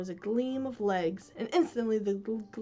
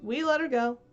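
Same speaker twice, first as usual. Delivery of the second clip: complacent; 4 semitones higher, 7 dB louder than usual. Acted. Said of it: "Smee answered complacently".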